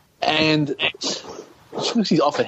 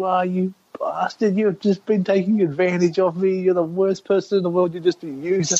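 high-pitched, half-strength mock yelling of abuse; mock screaming; 5 semitones higher than usual. Meth user berating the man who hosted her, mock screaming. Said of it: "she's screaming at him".

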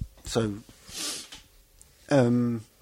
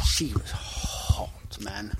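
whisper; 4 semitones lower, 10 dB quieter, much slower than usual. Real, not acted. Whispered aside.

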